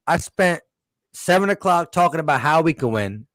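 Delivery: ordinary delivery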